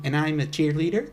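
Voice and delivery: American cheerleader, "cheerleader" swooping up; American teen uptalk. Acted. Mimicking a typical American teenager boasting, rising uptalk. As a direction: turn "cheerleader" into a question. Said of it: "And it's all, like, "Hi".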